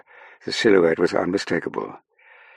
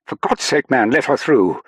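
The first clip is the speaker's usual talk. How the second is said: raised, high, exasperated call; impatient call; 8 semitones higher, 6 dB louder than usual. A narrator's reading. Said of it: "he called".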